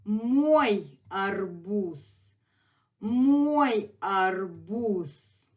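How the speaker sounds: мой арбуз said very slowly; slow demo. Shown as a demonstration. Teacher modelling мой арбуз for students, very slowly. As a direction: stretch out мой арбуз syllable by syllable. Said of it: "here is the other phrase".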